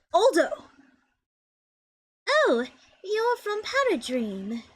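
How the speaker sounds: slow, light British lady voice; lightly voiced British accent.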